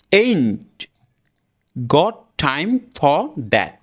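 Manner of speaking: slow, "ain't... got... time... for... that"; broken up word by word. Shown as a demonstration. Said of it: "যদি ভেঙে ভেঙে বলি". (ভেঙে ভেঙে ain't got time for that)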